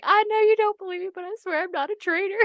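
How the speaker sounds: high-pitched, hammed-up crying plea; mock tearful pleading. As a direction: imitate someone freaking out in tears. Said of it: "freaking out, and he's, like, crying".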